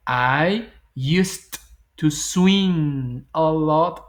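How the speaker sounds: swim drawn out, each word stretched; very slow demo. Shown as a demonstration. Teacher modelling answer to exercise one, very slow. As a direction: stretch I used to swim a lot slowly. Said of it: "Y entonces digo".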